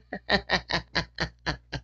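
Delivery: short breathy laugh; quiet amused laugh. Real, not acted. Laughing at own joke.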